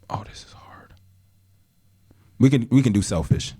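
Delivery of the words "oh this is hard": breathy whisper, thinking aloud; a soft unvoiced mutter while deciding; whispered aside. Real, not acted. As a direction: whisper it to yourself, stumped. Stumped choosing a song.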